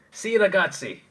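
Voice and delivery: high, loud mock Italian accent. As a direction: exclaim it in a put-on Italian accent. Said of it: "Imagine David with Italian accent".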